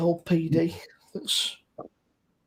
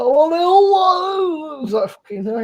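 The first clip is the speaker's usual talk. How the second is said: higher, hammed-up Hull exclamation; mock Hull accent; 7 semitones higher, slower than usual. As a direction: ham up his thick Hull accent. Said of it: "He's got quite a thick accent".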